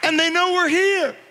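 loud, high, rousing preacher's cry; rally the crowd, building up; preaching at full force. Real, not acted.

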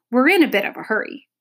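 fast, anxious rush; anxious hurry. A narrator's reading. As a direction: hurry the words out nervously. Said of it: "looking anxiously over his shoulder".

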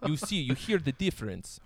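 put-on thick Russian-sounding Polish accent; imitated heavy Slavic accent; mock Russian accent. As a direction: explain it like a Russian-accented Bond villain. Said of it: "sound like every fucking James Bond villain".

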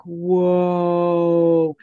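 long, drawn-out awed whoa; a held breath of amazement. Retold or imitated. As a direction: let the wonder stretch the word out. Awed reaction during the clearing.

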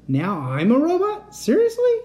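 very high, loud disbelief; incredulous. Acted. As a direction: squeak it in disbelief.

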